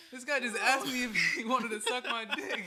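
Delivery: high, loud, half-laughing announcement; incredulous outcry. Retold or imitated. Skater tells his friends what the man asked, incredulous. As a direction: shout it to friends in comic disbelief. Retold outraged report to friends.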